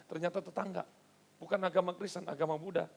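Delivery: ordinary talk, nothing marked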